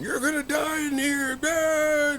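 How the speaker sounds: high-pitched screechy ghost woman; imitated ghostly scream. Ghostly woman threatening two friends in a cave, mock screaming. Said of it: "she started screaming and going".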